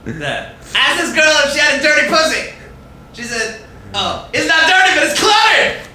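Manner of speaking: hammed-up high comic persona; squeaky stand-up voice. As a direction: deliver the dirty joke in a cartoonish squeal. Comedy bit in put-on voice.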